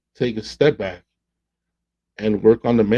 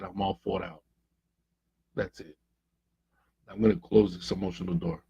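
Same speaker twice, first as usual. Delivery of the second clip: softer, a tired woman finally giving up; worn-out quiet; 3 semitones lower, 10 dB quieter than usual. Retold or imitated. Coach voicing the worn-out girlfriend who quits, quiet and tired. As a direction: let it drop away, all fight gone.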